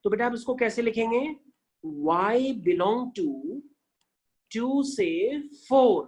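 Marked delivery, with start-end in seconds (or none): 2.1-6.0 very slow, stretched model reading; teacher modelling slowly; very slow demo. Shown as a demonstration. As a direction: draw each word out for the class. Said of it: "like this".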